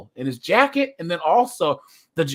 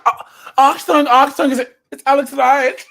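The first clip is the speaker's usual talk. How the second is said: loud hammed-up German accent, higher; mock German accent; 6 semitones higher, 7 dB louder than usual. Streamer imitating a German wrestler's entrance in a mock German accent. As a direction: announce yourself like a cartoon German wrestler. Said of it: "He'd be like".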